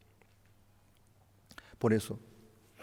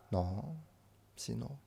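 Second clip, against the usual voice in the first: usual speaking voice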